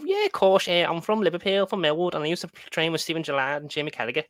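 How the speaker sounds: high, loud mock Liverpool accent; Scouse accent. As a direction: put on a hammed-up Scouse voice. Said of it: "a Scouse accent's easy to do".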